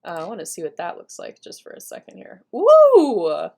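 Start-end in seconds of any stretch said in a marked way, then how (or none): 2.5-3.5 loud, high "woo"; excited whoop. Real, not acted. Presenter seeing the new keyboard map, excited whoop. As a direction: let out a big real cheer.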